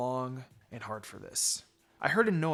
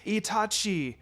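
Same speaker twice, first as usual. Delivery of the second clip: shout; 7 semitones higher, slower than usual. A narrator's reading. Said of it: "Izumi shouted while waving".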